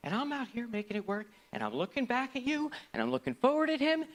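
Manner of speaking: usual speaking voice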